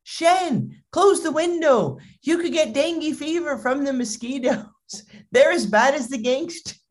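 high-pitched nagging mother; hammed-up motherly alarm; alarmed mom. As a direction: fuss in a high, overprotective mom voice. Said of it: "And what does his mom say?".

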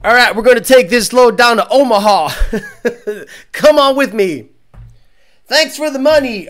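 loud, hyped-up trucker streamer; mock streamer hype. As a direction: ham up a chatty streamer hyping his chat. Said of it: "a guy's like".

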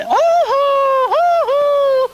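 high loud holler called back; a ringing answer holler. Shown as a demonstration. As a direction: call back so they know you're coming. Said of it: "I holler back to 'em and say".

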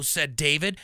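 ordinary delivery, nothing marked

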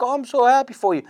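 high, gushing joy, imitating a mom; squealed mock-maternal delight; elated mother. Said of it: "She's crying because she's elated".

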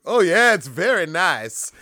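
high, loud smug reply; smug guard bragging; mock French guard. Guard's reply in retold scene.

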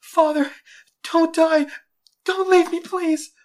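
put-on anguished, high, loud child's wail; crying out. A narrator's reading. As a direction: cry out desperately to a dying father. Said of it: "cried into the blood-stained feathers".

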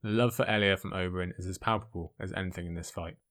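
ordinary delivery

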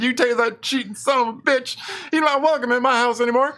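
mock angry Southern woman. Retelling Charlene's outburst.

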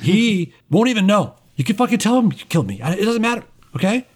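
high, hurried persuasion in character; eager pleading captive. Acted. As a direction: talk your way out in a rush. Captive pleads his case to runners.

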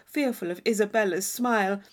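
usual speaking voice

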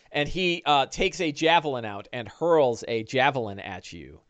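normal talking voice

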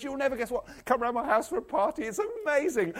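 high-pitched, bubbling good news; excited. Acted. Woman phoning friends about her found coin, excited. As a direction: burst out with the big invitation.

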